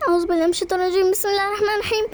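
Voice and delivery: frightened rushed prayer, pitch climbing; whispering a quick prayer for protection; startled prayer. Acted. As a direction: rattle off the prayer fast in fright. Startled girl mutters protective prayer.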